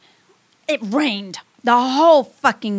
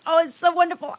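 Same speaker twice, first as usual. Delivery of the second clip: ordinary delivery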